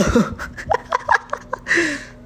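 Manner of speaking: breathy embarrassed giggle; high airy laugh. Real, not acted. Laughing at her own question. (mataas na tawang pabulong)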